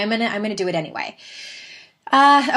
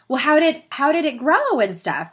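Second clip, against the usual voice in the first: high, louder imitation of an inquisitive child; six-year-old daughter; 9 semitones higher, 4 dB louder than usual. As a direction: mimic a little girl pushing for answers. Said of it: "And she's like".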